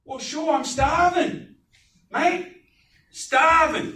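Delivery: high, loud Australian voice, dragging out starving; Aussie accent. Retold or imitated. Hungry Aussie vendor answering the water offer, high loud Australian accent. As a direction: play a hungry Australian hot dog vendor. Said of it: "Must have been an Aussie".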